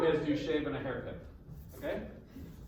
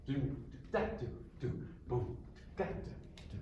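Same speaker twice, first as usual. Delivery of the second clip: "da" accented among softer "do" and "boom"; accented rhythm syllables; 4 semitones lower, 9 dB quieter than usual. Shown as a demonstration. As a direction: vocalise the beat, leaning on each "da". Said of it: "there's probably an accent there".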